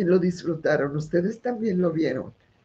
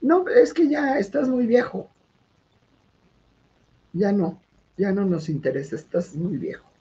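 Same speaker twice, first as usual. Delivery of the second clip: burla de la ejecutiva; 6 semitones higher than usual. Retold or imitated. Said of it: "le dijo".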